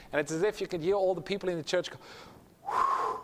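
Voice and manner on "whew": airy, voiceless "whew"; imitated sigh of relief. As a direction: blow out a mock sigh of relief.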